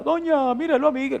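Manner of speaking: high-pitched tattling call; quick, high replay of a childish complaint; mock kid's shout. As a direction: imitate a child calling on a grown-up to intervene. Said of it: "le gritaba".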